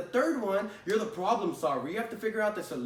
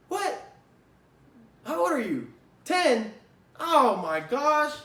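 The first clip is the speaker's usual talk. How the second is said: shocked disbelief; 7 semitones higher, 6 dB louder, slower than usual. Retold or imitated. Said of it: "And you're just like".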